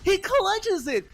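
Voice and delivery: high, loud hype call; excited esports caster. Real, not acted. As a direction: scream the clutch play-by-play. Caster erupts at a clutch play.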